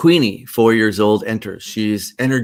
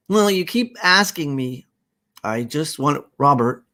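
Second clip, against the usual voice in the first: put-on raised little-girl voice; protesting child; 7 semitones higher, 4 dB louder than usual. Queenie protesting all the questions, high and childlike. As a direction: complain back in a high child's voice.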